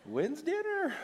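very high, sugary voice; exaggeratedly harmless, high-pitched asking; mock sweet innocence. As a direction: placate a partner in a squeaky sing-song. Phone call softening misread text.

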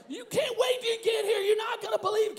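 high-pitched excited shout; hyped host voice. Retold or imitated. Imitating the host messaging the family, high excitement. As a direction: tease it like a fired-up TV host. Acting out host's iPad message.

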